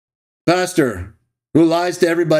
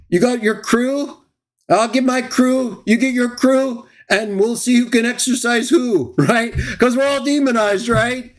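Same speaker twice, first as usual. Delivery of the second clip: higher, jeering dare with little laughs; mocking taunt; 6 semitones higher than usual. Real, not acted. Streamer taunting Michael about bringing his crew, jeering. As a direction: sneer at him like a schoolyard bully.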